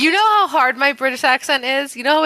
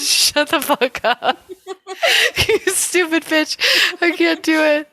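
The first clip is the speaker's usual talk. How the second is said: helpless giggles between the words; laughing; 3 semitones higher than usual. Real, not acted. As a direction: scold a friend while cracking up. Cracking up before the read.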